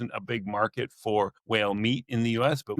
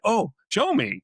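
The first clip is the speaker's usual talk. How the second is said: higher-pitched, mock-eager plea; imitated eager request; 10 semitones higher, 3 dB louder than usual. Bar companions asking to see the photos, imitated eagerness. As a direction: act out curious people asking to see. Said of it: "suddenly people would say".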